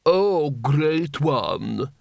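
very drawn-out, fawning address; nervous courtier. A narrator's reading. Plump courtier kneeling before the emperor, drawn-out fawning. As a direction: stretch it out obsequiously. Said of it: "nervously approaching the Emperor".

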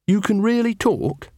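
high, slow disbelief; amazed question. Acted. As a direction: ask it wide-eyed, pitched right up. Carrot amazed the dog talks.

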